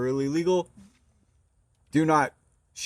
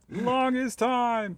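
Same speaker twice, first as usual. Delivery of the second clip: mock ad voice; 12 semitones higher, much slower than usual. Echoing ad phrase.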